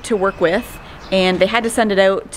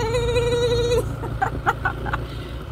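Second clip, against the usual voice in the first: high giggle; 6 dB quieter than usual. Real, not acted. Laughing on a bumpy ride.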